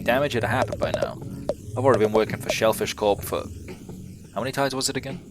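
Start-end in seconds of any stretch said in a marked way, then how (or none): none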